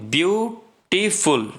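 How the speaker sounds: Beautiful broken into view, टी, फुल; drawn-out teaching demo of beautiful, syllable by syllable. Shown as a demonstration. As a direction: pause between the three syllables of beautiful. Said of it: "इसमें तीन syllable है".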